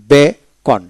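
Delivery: Beacon split as be, pause, kon; syllable by syllable. Shown as a demonstration. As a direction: teach beacon in two separate pieces. Teacher breaks word, then joins it.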